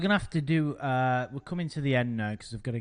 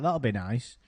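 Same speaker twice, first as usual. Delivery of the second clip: normal talking voice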